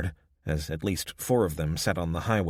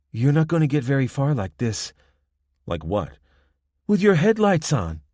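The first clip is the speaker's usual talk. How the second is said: put-on higher, louder Spanish-accented young woman, lecturing; confident young gang woman; 9 semitones higher, 6 dB louder than usual. Young woman at the roadblock warning the travelers, confident Spanish-accented lecture. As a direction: spell out the rules, sure of her power.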